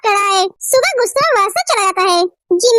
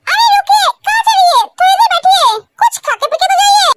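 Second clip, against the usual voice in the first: higher, eager coaxing; eager pleading; 6 semitones higher than usual. Acted. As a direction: keep him from leaving, brightly insistent. Girl eagerly urges guest to stay.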